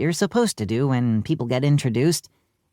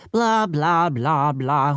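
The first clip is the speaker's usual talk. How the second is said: droning lecturer; much slower than usual. A narrator's reading. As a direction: drone on like a tedious professor. Lecture drones on.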